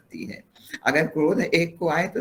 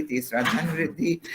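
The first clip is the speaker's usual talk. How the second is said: ordinary talk, nothing marked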